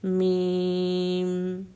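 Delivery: میم with lips pressed, long hummed م; lip letter demo. Shown as a demonstration. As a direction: say میم slowly, lips closing fully on م. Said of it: "دونوں ہونٹ اس طرح سے". (میم کو آہستہ، دونوں ہونٹ ملا کر)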